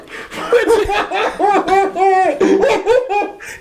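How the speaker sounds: high squeaky laugh; real high laughter; stifled laugh. Real, not acted. Laughing at funeral.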